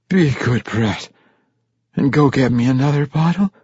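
put-on slurred drunk command; drunken order. A narrator's reading. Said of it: "Ivor Breen instructed".

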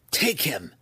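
imperious matriarch's order; commanding. Acted. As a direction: order your underlings to seize him. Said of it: "Malice commanded her two youngest daughters".

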